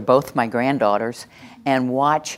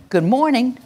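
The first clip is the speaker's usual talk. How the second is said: bright, higher good morning to a class; greeting the class with a lift in pitch; cheery greeting; 9 semitones higher than usual. Shown as a demonstration. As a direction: greet them with a big hello. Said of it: "I'm gonna say good morning to all of you".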